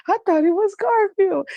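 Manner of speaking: high-pitched imitation of a guileless girl; innocent niece. Niece explaining the phone mix-up, imitated innocence. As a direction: imitate her innocently blurting it out. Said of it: "she was so innocent with it".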